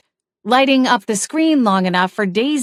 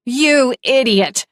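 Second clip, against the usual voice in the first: higher, louder and slow furious shriek; shrieked insult; 5 semitones higher, 4 dB louder, slower than usual. Acted. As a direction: scream it at her husband while wrestling. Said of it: "Daisy shrieked".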